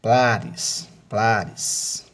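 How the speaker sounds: "plares" with the "pla" held long; word ending split off, PLA emphasised; stress on PLA. Shown as a demonstration. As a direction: lean on PLA in each "plares". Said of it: "a sílaba tônica dessas palavras é".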